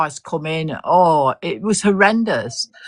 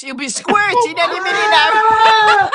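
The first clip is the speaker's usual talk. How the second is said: high, laughing through the words; giggling tease; 3 dB louder than usual. Real, not acted. Teasing Chris about the video, laughing. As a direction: tease him while cracking up.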